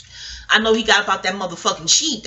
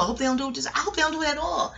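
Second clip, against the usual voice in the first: normal talking voice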